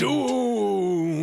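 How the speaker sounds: high, loud wailing doomed. Acted. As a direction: wail the word doomed. Repeating the doomsayer line.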